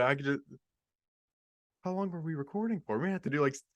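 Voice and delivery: ordinary talk, nothing marked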